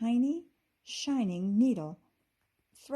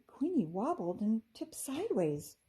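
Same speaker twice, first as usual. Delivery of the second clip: plain reading voice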